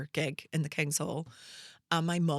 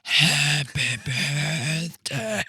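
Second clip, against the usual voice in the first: croaked song; 3 semitones lower, 6 dB louder, much slower than usual. Retold or imitated. Voiceless girl singing for the audition panel, strained croak. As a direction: drag the song out with a ruined voice. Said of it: "I had no voice".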